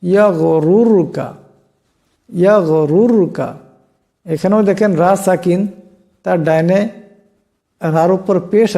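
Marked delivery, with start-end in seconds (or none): 0.0-3.5 ইয়াগরুরকা slowly, both র heavy. Shown as a demonstration. Teacher slowly showing ইয়াগরুরকা with heavy র sounds. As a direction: drawl ইয়াগরুরকা with thick র sounds. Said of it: "রা সাকিনকেও মোটা করিয়া পড়তে হবে".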